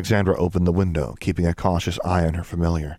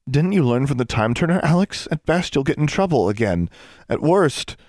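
worried pleading; 9 semitones higher than usual. Acted. Anna pleading with Alex.

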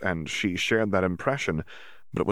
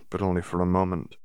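ordinary delivery

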